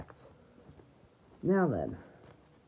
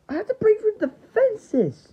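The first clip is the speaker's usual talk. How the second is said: high, urgent raised voice; battle cry; 10 semitones higher, 4 dB louder than usual. Acted. Player must break through defenses, high battle cry. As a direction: declare it high and determined.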